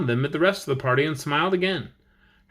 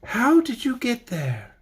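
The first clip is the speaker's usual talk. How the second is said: put-on girl's voice; 7 semitones higher, slower than usual. Girl's voice behind door.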